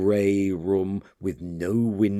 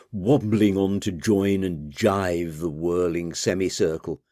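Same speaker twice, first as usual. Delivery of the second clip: usual speaking voice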